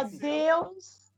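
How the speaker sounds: pleading Minas accent, rising at the end; Mineiro pleading voice. Shown as a demonstration. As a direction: say it as a Mineiro asking a favor. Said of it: "voz meio de pedir alguma coisa".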